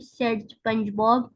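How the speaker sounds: usual speaking voice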